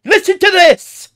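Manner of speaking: loud, high British parody; mocking British accent. Parroting the British man in the clip, mock British shout. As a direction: shout back a hammed-up British impression.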